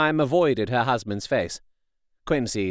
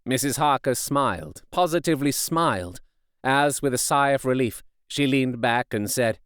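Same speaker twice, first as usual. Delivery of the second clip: plain reading voice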